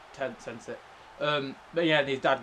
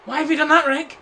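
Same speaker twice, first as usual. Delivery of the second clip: loud, high disbelieving shout; exasperated yell; 13 dB louder than usual. Real, not acted. Yelling at game character.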